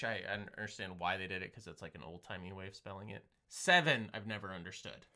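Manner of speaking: ordinary talk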